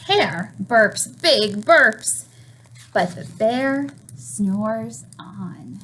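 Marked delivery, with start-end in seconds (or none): 2.9-5.8 slow, drawn-out storybook refrain; sleepy refrain. A narrator's reading. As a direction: let the line drift off like the bear sleeps. Refrain read to children.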